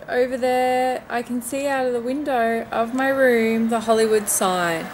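usual speaking voice

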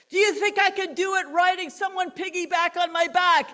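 loud, high challenge to the crowd; showman's call. Retold or imitated. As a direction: egg the crowd on.

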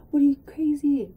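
imitating strangers scolding mom; scolding outcry. Passengers scolding mom for the stunt, loud mock scold. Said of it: "people are, like, talking to her".

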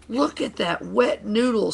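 high-pitched sneer; storyteller's scornful racer; snapping. A narrator's reading. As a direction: snap it mockingly at a rival. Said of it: "snapped Stinky".

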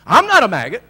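shouted back hard and loud; defiant shout. Retold or imitated. Preacher retorting to the man, loud defiant shout. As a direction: fire it back at him full force. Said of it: "this thing come up".

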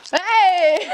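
«Hey!» aigu et lancé fort; cri de surprise joué. Pretend. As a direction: surgir d'un coup pour faire peur. Surgit de sa cachette pour surprendre. (cri joyeux pour surprendre)

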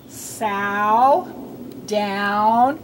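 "Sow, down" each stretched, rising; isolated "Sow, down", long lifted vowels; drilling OW words. Shown as a demonstration. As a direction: draw out each OW with a lift. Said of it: "say the ow words with me".

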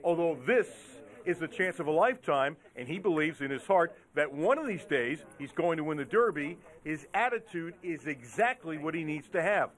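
low, measured TV voiceover; news feature narration. A narrator's reading. Reporter profiles the calm trainer before the Derby, low feature voiceover.

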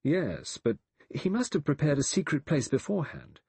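concede, then push back with a but; reluctant. A narrator's reading. Lombard reluctantly argues with Vera. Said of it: "Lombard said reluctantly".

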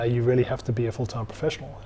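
ordinary talk

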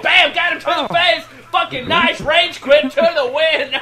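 loud, high-pitched commentator burst; excited kill call. Real, not acted. Commentator calling a hit to the face, excited and loud. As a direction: punch it out like a big play.

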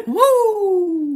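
high, loud wrestler's whoop; Ric Flair. Acted. Cheering a viewer's sub count, Ric Flair whoop. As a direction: let out a showy, high "woo". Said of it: "Give him that Ric Flair".

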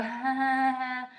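weak, nervous fake laugh; a timid giggle to back down. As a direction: laugh it off meekly. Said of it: "they'll typically just go".

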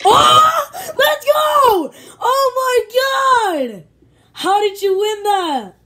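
very high, loud cheering scream; ecstatic shout. Real, not acted. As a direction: scream with joy at the win. Friend wins the race.

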